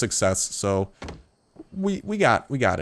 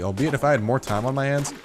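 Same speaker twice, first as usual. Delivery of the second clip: usual speaking voice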